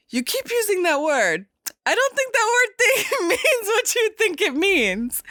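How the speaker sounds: lightly voiced Inigo. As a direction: tease the word back at him. Inigo quote.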